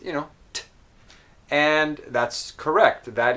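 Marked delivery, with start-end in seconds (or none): 0.5-0.6 a lone crisp t with a puff of air; isolated, released t; released T sound. Shown as a demonstration. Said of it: "what you learn as the T sound".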